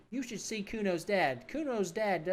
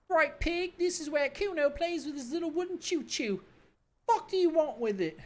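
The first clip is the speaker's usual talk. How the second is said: put-on bratty kid Kuno; 12 semitones higher, 3 dB louder than usual. Cocky kid mocking the cop, in a high bratty voice. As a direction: sneer it like a cocky street kid.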